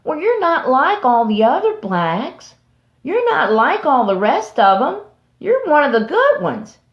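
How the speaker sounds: mock patronizing praise; condescending speaker. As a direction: talk down sweetly. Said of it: "those people that said".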